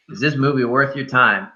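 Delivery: ordinary talk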